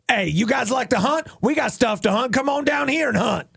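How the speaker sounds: high, shouting sales pitch; infomercial pitch. Retold or imitated. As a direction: sell it like a TV ad. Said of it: "Sounds like an infomercial".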